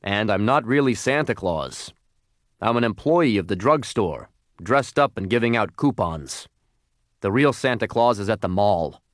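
put-on voice of a store Santa; matter-of-fact fake Santa voice; drugstore Santa, dismissive. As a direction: set them straight as a hired Santa.

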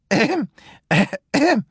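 high mock throat-clearing; fake cough. A narrator's reading. Wolf faking a cold for the girl, mock ahem. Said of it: "pretending to cough".

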